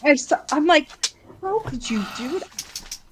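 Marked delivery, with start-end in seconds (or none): 1.4-3.0 slow, softened, appalled scolding; retold reproach, drawn out and hushed. Mother retelling how she confronted her thieving son, hushed reproach.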